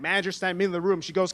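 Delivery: normal talking voice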